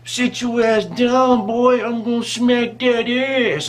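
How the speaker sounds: slow, high, stretched-out imitated scolding; mock threat. Sister threatening the young bystander, slow high mock scolding. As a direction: drag it out like an irate woman laying down the law.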